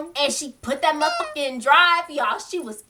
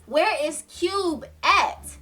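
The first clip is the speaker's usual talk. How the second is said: slow, higher mock demand; insistent demand; 5 semitones higher, much slower than usual. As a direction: draw out each word, imitating an insistent caller. Retold phone standoff, repeating her demand.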